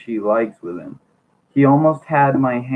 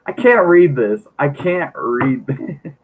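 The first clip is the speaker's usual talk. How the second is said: helpless amusement; 2 semitones higher, slower than usual. Real, not acted. Reader breaking off from the book, giggling for real. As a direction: lose it laughing, unable to go on.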